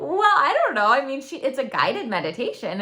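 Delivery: high-pitched, protesting mock reply; defensive hedging. Answering a friend who called it not normal, hammed-up hedging.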